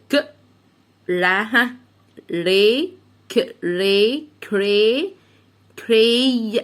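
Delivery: teacher modelling "كري" piece by piece; sounding out. Shown as a demonstration. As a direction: separate ك and ري, then blend into "كري". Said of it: "قول معايا".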